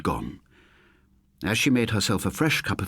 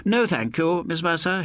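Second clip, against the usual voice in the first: firm, raised refusal, pitched higher; said loudly; 10 semitones higher than usual. Acted. Lodger refuses the landlady's offer, said loudly. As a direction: brush her off loudly but politely. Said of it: "he said loudly".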